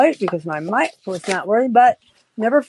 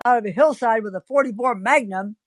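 usual speaking voice

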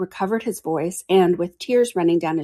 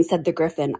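usual speaking voice

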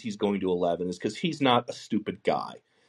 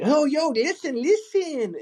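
high, drawn-out mock Puerto Rican accent; slow, exaggerated Puerto Rican accent; fake Puerto Rican accent; 4 dB louder, slower than usual. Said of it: "fake Puerto Rican accent".

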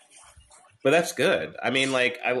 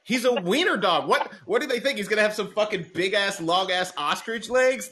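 high-pitched incredulous rant; indignant disbelief; 9 semitones higher than usual. Real, not acted. Host outraged at the vets over a wiener dog, indignant. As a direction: protest the absurdity, pitch riding high.

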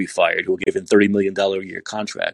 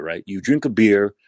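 ordinary talk, nothing marked

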